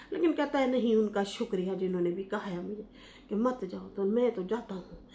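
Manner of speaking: ordinary delivery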